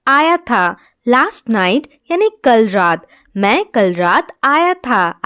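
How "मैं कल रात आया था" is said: ordinary talk, nothing marked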